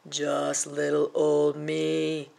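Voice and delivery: slow, lumbering giant voice; giant's bellow. Acted. Giant answering the troll, slow and lumbering. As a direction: drawl it out like a huge giant. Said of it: "bellowed the giant with a sniff".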